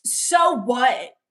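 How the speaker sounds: loud, snapping defiance; defiant shout. Acted. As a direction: throw it back at him, loud. Angry retort at stepdad.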